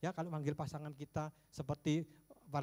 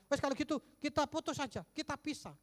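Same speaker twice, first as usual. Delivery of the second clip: mock quarrel; 8 semitones higher than usual. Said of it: "langsung ngomongnya".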